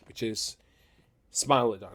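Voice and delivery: ordinary talk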